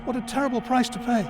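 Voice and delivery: a storyteller reading a grim fairy tale; story reading. Acted. Author reading his fairy tale to listeners. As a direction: read it like a dark bedtime tale.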